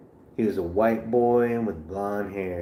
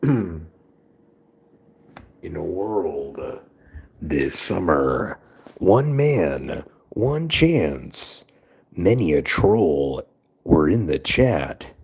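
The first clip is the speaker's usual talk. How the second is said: booming trailer narrator, louder, slow at first; movie trailer voice; 2 semitones lower, 4 dB louder, slower than usual. Pretend. Telling chat about the trolls, in a booming trailer voice. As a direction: sell it like a summer blockbuster.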